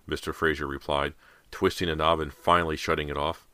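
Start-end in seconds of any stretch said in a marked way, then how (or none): none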